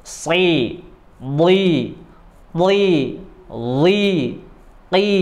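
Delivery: si, li, ki kept thick though with i; heavy si li. Shown as a demonstration. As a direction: keep them full and dark. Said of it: "Tetap dibaca tebal". (dibaca tebal)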